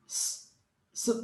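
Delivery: S as an unvoiced hissing sss; hissed S sound. Shown as a demonstration. Teacher modelling the sound of S as a hissing sss. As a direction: hiss the S with no voice. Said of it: "Olha os sons".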